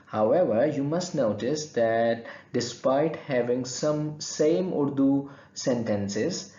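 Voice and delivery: ordinary talk, nothing marked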